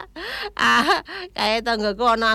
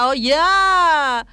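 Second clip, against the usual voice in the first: normal talking voice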